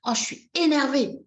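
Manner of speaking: demonstrated crossness; acted annoyance. Shown as a demonstration. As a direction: vent your irritation for show. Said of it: "Et là, on va faire".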